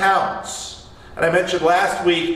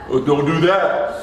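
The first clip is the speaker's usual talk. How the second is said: Ronnie; 6 semitones lower than usual. Retold or imitated. Teased coworker begs off another prayer, New Jersey accent. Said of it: "in his thick New Jersey accent".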